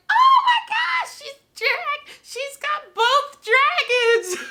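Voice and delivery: high, loud squeal of delight; slow high squeal over the dragons; squealing with joy. Real, not acted. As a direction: shriek it out like a thrilled fan. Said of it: "I'm just, like, so happy".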